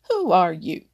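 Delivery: loud demand from a fox; a cocky fox demanding to know who you are. A narrator's reading. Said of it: "he demanded".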